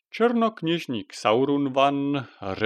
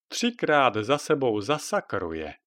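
ordinary delivery, nothing marked

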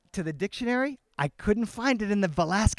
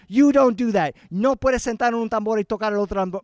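raised, mock scolding voice; retold yelling; 4 semitones higher, 6 dB louder, faster than usual. Brother scolding his nephew on the drum, mock yelling. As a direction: lay down the rule in a mock yell. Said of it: "he started yelling at my son".

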